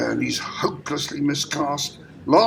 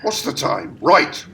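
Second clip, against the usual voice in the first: ordinary talk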